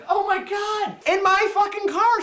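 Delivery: high-pitched shocked cry, slowing on the end; disbelieving outrage. Retold or imitated. Watching a friend steal his car, high shocked disbelief. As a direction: voice jumps up in disbelief.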